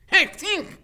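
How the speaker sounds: very high, loud, squeaky creature sneeze; a sudden squeaky blast; dragon sneeze. Acted.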